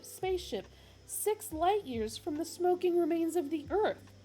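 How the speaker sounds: high, loud aggrieved rant; complaining Arthur. Acted. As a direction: complain like a wronged man. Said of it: "complained Arthur".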